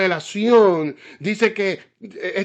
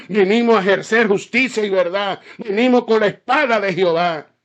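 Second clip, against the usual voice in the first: louder, higher, fiery pulpit preaching; preaching at full force; 4 semitones higher, 6 dB louder than usual. Acted. Pastor rousing the congregation about justice, fiery preaching. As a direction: pound it home from the pulpit like a revival preacher.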